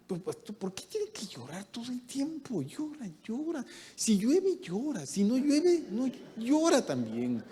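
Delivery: high, whining mock complaint; a husband griping about his wife's tears; complaining husband. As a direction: whine to the pastor about her crying. Husband complains wife always cries.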